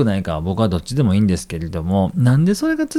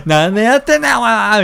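much higher, louder mock shout; retort shout; 12 semitones higher, 6 dB louder than usual. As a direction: snap back at him in disbelief. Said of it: "みたいな感じで".

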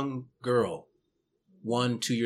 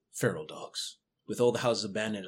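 ordinary talk, nothing marked

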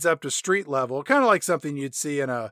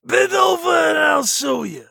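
mock shout; 12 semitones higher, 6 dB louder than usual. Joking chief's crude reply.

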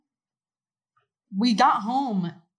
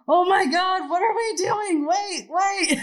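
high, loud panicked wail; hammed-up distraught cry; mock bawling; 10 semitones higher, 8 dB louder, slower than usual. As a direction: reenact a tearful freak-out, pitched way up.

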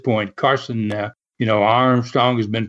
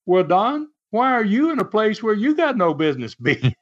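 high-pitched retort, rising at the end; pointed comeback, pitched up; Les Thatcher firing back; 8 semitones higher than usual. Retold or imitated. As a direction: throw the question back, lightly imitated. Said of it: "So Les fired back".

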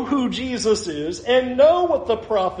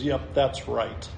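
soft, low, slow matter-of-fact reply; subtle confirmation; 8 semitones lower, 6 dB quieter, slower than usual. Acted. Jesus answering the objecting leaders, understated confirmation. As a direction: drop it casually, with no fuss. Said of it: "Jesus very subtly goes".

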